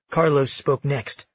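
usual speaking voice

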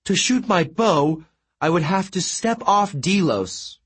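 a trapped god complaining; crying out. A narrator's reading. Apollo explains to Leo that he cannot leave Delos, mildly distressed. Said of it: "Apollo cried".

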